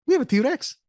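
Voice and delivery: put-on Scottish accent, lightly done, announcing a T-Rex. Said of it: "whenever he goes".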